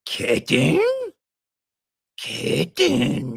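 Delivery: high dramatic rising then falling Kitten?; murder-mystery climax voice; eighties movie reveal. Shown as a demonstration. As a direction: say Kitten like you just unmasked the killer. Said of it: "You gotta say it like that".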